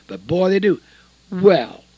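normal talking voice

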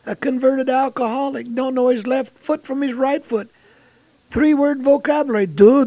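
put-on high, loud pleading prayer of an old deacon; fervent prayer. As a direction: cry out to the Lord, pleading hard. Retells deacon's fervent prayer aloud.

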